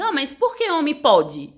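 pleading why the rules differ; indignant child's question. Retold or imitated.

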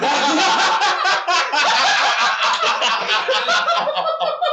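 helpless laughing fit; burst of laughter. Real, not acted. Friend cracks up at the joke, loud high laughter.

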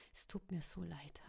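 whispered, unvoiced and soft; breathy whisper. A narrator's reading. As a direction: breathe the words out gently. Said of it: "hauchte er".

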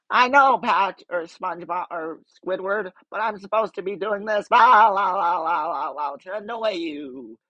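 high, cheery SpongeBob voice; sing-song the la las to annoy; lightly voiced chirpy sponge; SpongeBob impression. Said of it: "I can do Squidward".

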